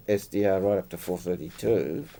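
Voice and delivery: ordinary talk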